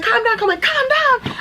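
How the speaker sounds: high mock cop soothing, calm down; lightly voiced cop pleading for calm; a hint of a flustered cop; imitating the cop. Retold cop calming her down.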